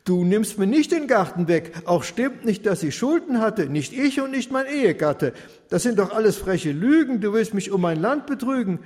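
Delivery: the widow refusing him, pitch climbing; indignant widow. Acted. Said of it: "spricht sie".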